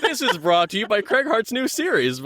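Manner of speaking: high, put-on announcer voice through laughter; hammed-up, high-pitched intro plug; mock sponsor plug. Guest working his series into the intro, mock sponsor voice. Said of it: "just work it into the intro".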